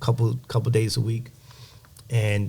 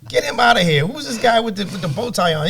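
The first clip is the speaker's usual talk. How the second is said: high, loud mocking voice, laughing; mocking commenters; 12 semitones higher, 4 dB louder than usual. Voicing mean online commenters in a high jeering voice. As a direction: sneer at the guy in a high jeer. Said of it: "Now they're like".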